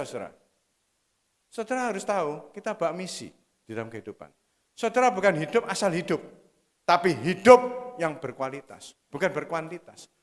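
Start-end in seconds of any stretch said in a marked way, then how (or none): 4.7-10.1 preaching at full force. Acted. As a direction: preach it hard, hammering each bukan. Khotbah penuh semangat. (khotbah berapi-api dengan tekanan keras)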